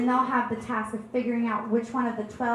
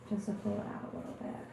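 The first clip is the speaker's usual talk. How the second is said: hushed confession; 10 dB quieter, faster than usual. Acted. Whispered confession.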